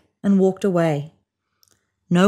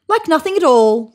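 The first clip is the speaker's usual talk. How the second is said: dismissive insistence; 9 semitones higher, 5 dB louder than usual. A narrator's reading.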